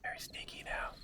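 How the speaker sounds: hushed unvoiced whisper. Real, not acted. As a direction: whisper it like hiding. Zombies hunting at night.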